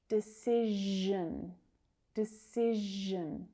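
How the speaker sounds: Decision slowly, holding the zh in the middle; slow Decision with a long de-CIZH-un; lengthened zh. Shown as a demonstration. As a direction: draw out the buzzing zh in Decision. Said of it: "zh sound".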